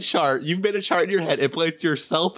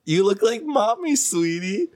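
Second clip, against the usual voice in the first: mock baby talk; 9 semitones higher, slower than usual. Teasing baby-talk to friend.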